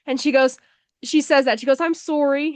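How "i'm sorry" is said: higher-pitched imitation of a Canadian girl; Canadian "sorry", said "sore-ee"; Canadian accent. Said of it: "this Canadian exchange student".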